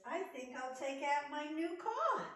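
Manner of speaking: high, loud, happy little bear voice; cheerful teddy bear. Acted. Teddy bear greeting his spring friends, high and bright. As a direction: greet every friend with delight.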